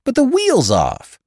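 very high, loud, slowed protest; high incredulous cry; exasperated objection. A narrator's reading. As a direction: point out the obvious in disbelief, voice way up. Exasperated bystander objects to dazed driver.

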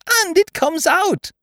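very high, loud, drawn-out squeak; delighted Piglet. A narrator's reading. Piglet watching the balloon come out, delighted.

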